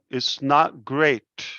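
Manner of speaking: normal talking voice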